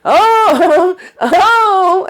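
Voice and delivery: high squeaky oh, oh, oh with a laugh; high giddy squeal breaking into a laugh. Retold or imitated. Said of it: "And then he going".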